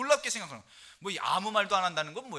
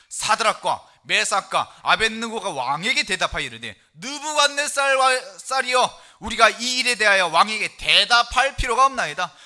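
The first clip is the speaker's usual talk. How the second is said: loud, slow proclaiming of the verse; full-force preacher reading Daniel; solemn scripture reading; 3 semitones higher, 11 dB louder, slower than usual. Acted. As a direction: declaim it like a pulpit reading. Reads Daniel aloud as preacher.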